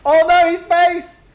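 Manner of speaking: high, loud, stretched cry of alarm; excited alarm. Real, not acted. Caster cries out as the enemy base is hit, high and loud. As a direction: shout it as the base falls.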